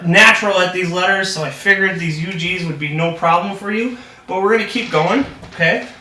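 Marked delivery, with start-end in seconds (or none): none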